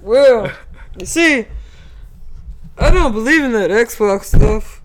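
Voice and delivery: put-on high, loud drunk hobo drawl. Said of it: "drunk hobo".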